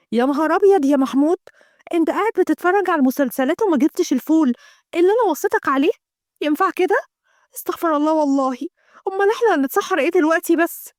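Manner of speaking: high, exasperated scolding; nagging wife. Acted. Abeer scolds Mahmoud for forgetting the beans, exasperated.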